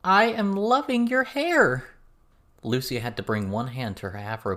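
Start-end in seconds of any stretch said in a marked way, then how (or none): 0.0-1.8 high, loud, slow and gleeful; gleeful delight. A narrator's reading. Verona delighted by Lucy's pink hair, high, loud and gleeful. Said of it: "with mirth in her voice".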